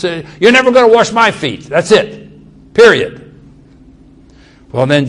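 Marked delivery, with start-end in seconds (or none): 0.4-3.3 fast, loud and higher, slamming the door on it; harsh refusal. Retold or imitated. Peter refusing Jesus with harsh finality, loud and fast. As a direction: bark out a flat no, louder and higher. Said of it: "he went right to this harshness".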